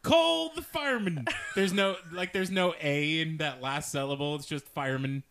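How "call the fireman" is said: very high, loud mocking cry; hammed-up high-pitched holler; mock urgent call. Tim mocks her pronunciation, hammed-up call for help.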